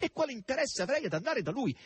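high-pitched, indignant question; dismayed outcry. A narrator's reading. Margherita questions going to the stranger, dismayed. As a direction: protest with the voice pitched right up. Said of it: "esclamò nel suo sgomento Margherita".

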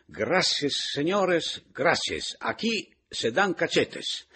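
loud, high Spanish shrieking; shrill, sarcastic Spanish outcry. A narrator's reading. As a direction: shriek indignantly in Spanish, drawing out the last phrase. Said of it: "Pepita Pantogada strillava".

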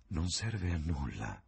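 slow, weary sighed words; sighing. A narrator's reading. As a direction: let it out on a slow sigh. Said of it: "sospirò Adrian Singleton".

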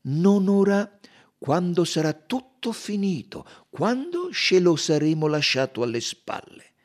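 loud, high detective quoting with deliberate emphasis; Poirot incalza. Acted. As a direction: press the witness as Poirot, voice up. (Poirot inquisitore, voce forte e acuta)

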